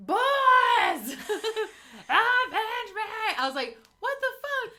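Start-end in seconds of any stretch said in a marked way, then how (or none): 0.1-3.0 mock dying scream; hammed-up last cry for revenge; overacted death scene. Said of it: "as soon as he started screaming boys". 4.0-4.7 high, stunned outburst; shocked. Retold or imitated. Shocked reaction to the scene.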